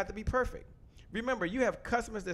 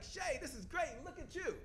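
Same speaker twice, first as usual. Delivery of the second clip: mock admiring viewer; 8 semitones higher, 10 dB quieter than usual. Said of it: "You're like".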